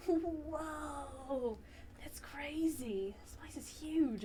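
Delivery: hushed amazement at the huge room; Amber's hushed awe. Acted. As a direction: breathe it out in wonder. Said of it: "Amber's like".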